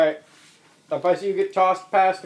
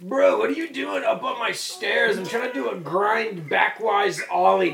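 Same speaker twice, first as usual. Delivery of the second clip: slow, raised bro drawl; radical dude; 5 semitones higher than usual. Acted. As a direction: drawl it like an indignant skater bro. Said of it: "[name] will translate it".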